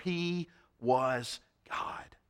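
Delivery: slow, hushed whisper; the preacher whispers the revelation to the congregation; awed whisper. Real, not acted. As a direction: breathe the words in awe. Awed climax of the sermon.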